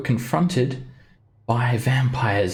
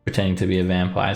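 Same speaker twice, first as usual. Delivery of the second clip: ordinary talk, nothing marked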